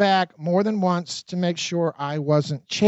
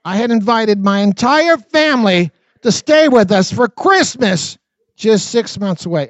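louder, punching CHRISTMAS and "just six months away"; emphatic retake; 4 semitones higher, 5 dB louder than usual. Shown as a demonstration. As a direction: say it again, hitting "Christmas" hard and higher. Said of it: "Let me say that again".

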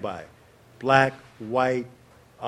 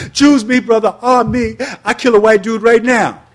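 much higher, loud eager shout; 10 semitones higher, 13 dB louder than usual. Retold or imitated. Teen leaping up at the Panther meeting, shouting eagerly. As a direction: jump up and volunteer at full voice. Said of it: "I was like".

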